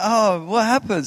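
dazed wonder, a little calmer; bewildered. Retold or imitated. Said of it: "And I was like".

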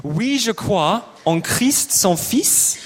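slow French, Oui, je crois en Christ son Fils; French lyric demo. Shown as a demonstration. Leader models the French song for the congregation, slow. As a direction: model each word slowly. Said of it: "I'm gonna say the words in French".

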